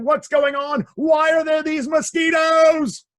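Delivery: high, loud, slowed shout; exasperated king shouting. Acted. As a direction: bellow it at the swarm like a cranky king. King yells at swarm.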